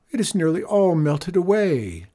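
high lament, lightly played; mock sobbing. A narrator's reading. Farmer mourns the melted moon, lightly sobbing. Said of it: "sobbed Abel".

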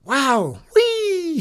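whooping, very high and loud; ride-along whoops, voice leaping up; called out. A narrator's reading. Boy on a bucking chair, calling out. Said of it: "he called out".

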